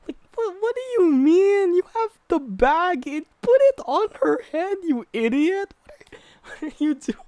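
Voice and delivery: shrieking at the dumb characters; high, incredulous outburst at the screen; exasperated high yell. Real, not acted. Viewer yells at the film characters, high and exasperated.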